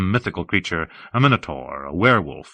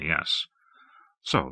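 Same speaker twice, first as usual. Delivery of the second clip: usual speaking voice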